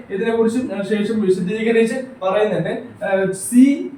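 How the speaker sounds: ordinary talk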